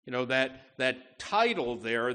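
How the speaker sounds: usual speaking voice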